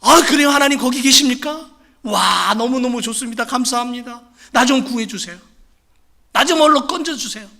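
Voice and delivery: overjoyed relief. Acted. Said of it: "너무너무 좋아가지고".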